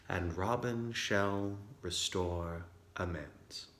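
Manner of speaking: soft, measured verse recitation, falling at line ends; Shakespeare recitation. Acted. Puck's closing monologue recited to the audience, soft and measured Shakespeare. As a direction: recite it quietly, with pauses between phrases. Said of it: "There's me trying to recite Shakespeare".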